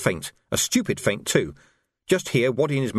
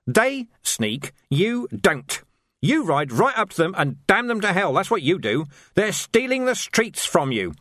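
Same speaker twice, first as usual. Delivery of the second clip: manic rage; 8 semitones higher than usual. A narrator's reading. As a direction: hammer every line like a zealot. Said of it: "the manic rage subsided".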